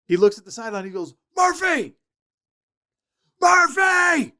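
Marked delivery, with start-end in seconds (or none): 1.4-4.3 high shout that builds louder each time; shouting a name. Retold or imitated. Assistant yelling across the field at the walk-on, shout that builds. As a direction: call across the practice field, getting angrier.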